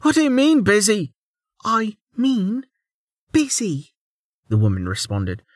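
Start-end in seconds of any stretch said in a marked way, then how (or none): 0.0-1.1 high, incredulous challenge; indignant demand. A narrator's reading. Servant questioning why they're busy, indignant demand. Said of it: "the servant demanded". 1.6-4.0 "busy" set apart after a pause, high and deliberate; pause, then lean hard on "busy"; emphasis on "busy". A narrator's reading. Woman answering the servant's challenge, stressing "busy". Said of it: "emphasizing the word".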